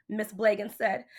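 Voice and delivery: ordinary delivery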